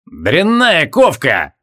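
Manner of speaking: gruff proclamation. A narrator's reading. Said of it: "провозгласил Джориан".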